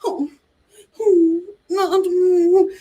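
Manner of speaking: lightly pouty whimper; a touch of a fake pout; mock pout. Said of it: "she pouts".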